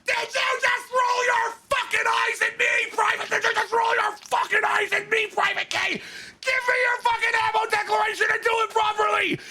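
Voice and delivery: very high, furious shouted sergeant; screaming drill sergeant. Retold or imitated. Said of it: "And the sergeant goes".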